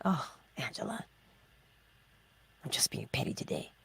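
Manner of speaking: unvoiced, soft admission; whispered self-talk. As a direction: whisper it to yourself. Acted self-talk realization.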